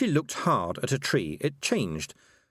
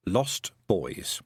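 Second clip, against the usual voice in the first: plain reading voice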